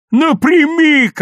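high, drawn-out shout; long, loud battle cry; yelling. A narrator's reading. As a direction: holler it to clear the way. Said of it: "орал Лёвка".

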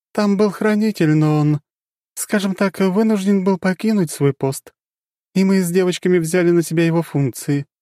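high, explaining calmly; dark goddess confiding. Acted.

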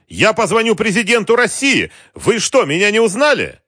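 very high, loud outrage; angry outburst. A narrator's reading. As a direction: pull rank in a furious cry. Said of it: "гневно сказал президент". (гневный крик, очень высоко)

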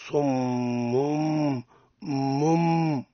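"صم" ending in a long, held hummed m; iqlab demonstration. Shown as a demonstration. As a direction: hold the meem of "صم" as a sustained nasal hum. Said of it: "هكذا تقرأ". (نطق "صم" بميم مغنونة ممدودة)

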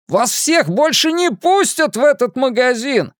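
высокий громкий крик вслед; прокричать угрозу высоким голосом. A narrator's reading. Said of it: "прокричал ему вслед продавец". (продавец кричит вслед убегающим, громко)

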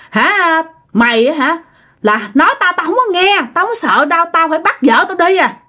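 high, scornful challenge; taunting ghost. Retold or imitated. Ghost husband taunting the exorcist, high and scornful. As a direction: sneer that you fear nobody.

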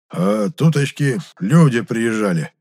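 put-on slow, measured old man's telling; slow and stately; sedate, unhurried. A narrator's reading. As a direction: take your time, drawing the words out. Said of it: "степенно, не торопясь".

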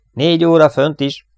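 high, loud call over the intercom; shout. Acted. Eugene shouts the bearing to the crew, loud. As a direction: yell the bearing out. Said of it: "kiáltotta Eugene".

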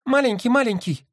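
very high squeaky bird echo; squeaky toy. A narrator's reading. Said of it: "пропищал голубь".

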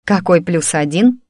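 usual speaking voice

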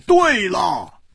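triumphant booming hero; loud exclaiming. Acted. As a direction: slap the table and announce it to everyone. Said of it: "大声说".

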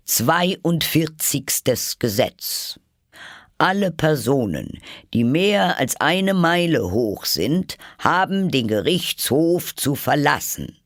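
high, slow, loud royal decree; pompous proclamation. Acted. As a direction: read the law like a pompous king. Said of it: "las dann aus seinem Buch vor".

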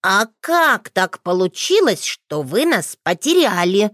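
put-on a nosy little boy pressing on; a touch of a pushy child; persistent. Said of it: "Настырность этого маленького почемучки".

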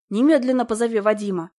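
demanding. A narrator's reading. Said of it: "и потребовала".

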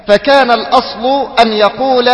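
ordinary delivery

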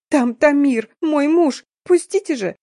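high, distraught acted plea; tearful pleading. A narrator's reading. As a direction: beg tearfully, pitch climbing steeply. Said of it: "заплакала".

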